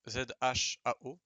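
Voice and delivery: ordinary talk, nothing marked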